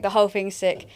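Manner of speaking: normal talking voice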